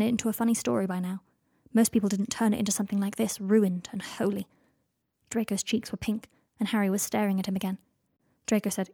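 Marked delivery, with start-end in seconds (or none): none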